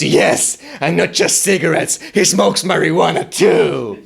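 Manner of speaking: high, gleeful tattle; tattling sister. Retold or imitated. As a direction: rat him out with glee. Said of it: "my sister interrupts and goes".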